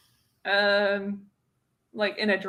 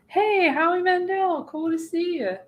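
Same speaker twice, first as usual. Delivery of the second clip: eager fan greeting; 8 semitones higher than usual. Retold or imitated. Fan greeting a celebrity they spotted, eager and high. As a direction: re-enact a bright, higher-pitched hello.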